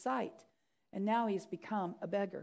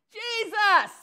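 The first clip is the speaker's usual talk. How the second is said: high, forceful shout; insistent high holler; louder yell; 10 semitones higher, 10 dB louder than usual. Acted. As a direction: call out to be heard over the crowd. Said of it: "yelled louder".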